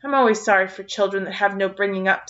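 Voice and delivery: ordinary delivery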